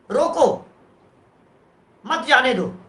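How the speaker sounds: रोको set apart, then मत जाने दो together; pause after रोको. Shown as a demonstration. Teacher showing where the comma falls, pause after रोको. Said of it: "comma".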